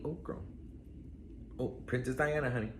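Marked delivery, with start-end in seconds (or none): none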